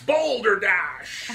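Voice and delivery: slow, drawn-out booming word; grand proclamation. Acted.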